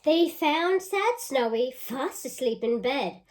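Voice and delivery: lively storybook reading; reading to children. Acted. Teacher reading a picture book aloud to children. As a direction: read it out with relish for the kids.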